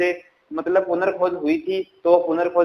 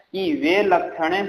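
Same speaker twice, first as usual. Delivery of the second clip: slow dictation; slower than usual. Shown as a demonstration. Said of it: "क्या बोला मैंने".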